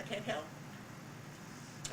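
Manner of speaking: soft, breathless, slow and whispered; a patient too weak to speak aloud, drawn out slowly; patient's helpless whimper. Retold or imitated. Patient's reply in the tooth story.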